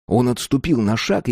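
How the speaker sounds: ordinary delivery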